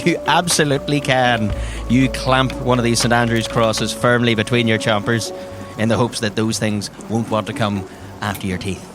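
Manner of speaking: ordinary talk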